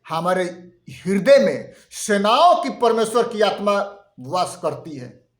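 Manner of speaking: usual speaking voice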